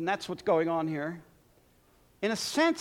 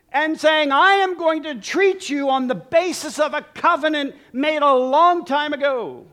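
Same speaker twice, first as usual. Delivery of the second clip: loud, higher, emphatic covenant promise; fervent declaration; 5 semitones higher, 7 dB louder than usual. Acted. David speaking to Mephibosheth, loud and fervent. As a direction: proclaim it like a king's vow.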